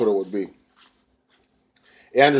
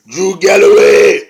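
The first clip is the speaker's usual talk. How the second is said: loud, drawn-out name call; booming mock fight introduction; mock ring announcer; 8 semitones higher, 10 dB louder, slower than usual. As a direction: belt out the main-event name. Announcing the main event.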